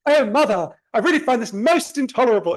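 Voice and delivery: high, loud mock-posh little boy; exaggerated posh accent, affronted at mother. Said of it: "I have this weird posh accent".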